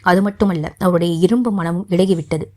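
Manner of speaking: ordinary delivery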